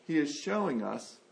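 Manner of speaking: ordinary talk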